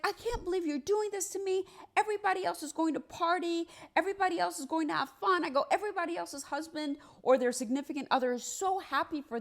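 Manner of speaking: high-pitched, wounded pleading; retold tearful complaint. Wife to husband after he yells, mock tearful complaint. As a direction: act out a hurt protest, voice way up. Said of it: "So I start crying".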